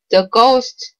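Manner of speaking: ordinary delivery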